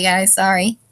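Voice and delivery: ordinary talk, nothing marked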